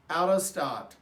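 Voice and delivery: usual speaking voice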